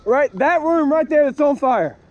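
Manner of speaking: high, urgent shouting over noise. Real, not acted. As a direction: call out urgently to the homeowner. Calling homeowner at burning house.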